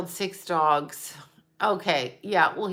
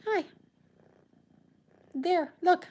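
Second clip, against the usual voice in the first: higher sing-song pet talk; cooing to a pet; 10 semitones higher, 4 dB quieter than usual. Real, not acted. Calling her cat over, higher cooing pet voice.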